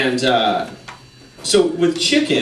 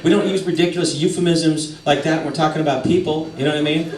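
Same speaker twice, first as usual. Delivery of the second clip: normal talking voice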